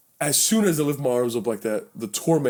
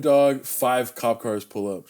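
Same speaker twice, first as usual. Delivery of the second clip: usual speaking voice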